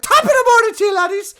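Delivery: high screamed Irish greeting; Jacksepticeye. Retold or imitated. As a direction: scream it at the camera in a hammed-up Irish accent, very high and loud. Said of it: "he starts screaming at the fucking camera".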